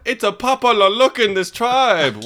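hammed-up mock Wiseau accent, slowed and loud. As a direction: parody the friend's Wiseau accent, drawn out and hammed up. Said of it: "What the fuck accent was that?".

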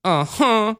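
put-on falling, reluctant little-girl uh-huh; begrudging child. Shown as a demonstration. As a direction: give in sulkily, like a small child. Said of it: "that was more begrudging".

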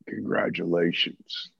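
low, slow, drawn-out congratulations; Jake's greeting. Retold or imitated. Jake calling after a long silence, low and slow. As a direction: stretch it low and knowing.

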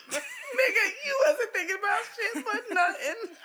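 near tears with laughter; laughing. Real, not acted. Cracking up at her man's answer.

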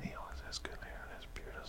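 soft hushed whisper. Real, not acted. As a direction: whisper it so he can't hear. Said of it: "whisper into the mic".

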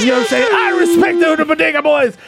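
comic high-pitched squeak; squeaky high voice. Acted. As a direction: jump way up high.

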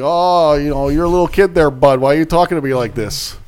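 hammed-up Canadian accent, high and loud; polite Canadian accent. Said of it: "it's like a Canadian insult".

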